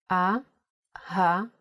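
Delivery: slow "A-ha-va" with a clear gap between syllables. Shown as a demonstration. Teacher spelling the word with nikud, slowly syllable by syllable "A-ha-va". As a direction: spell out "A-ha-va" slowly in three separate pieces. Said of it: "let's spell ahava with the full nikud".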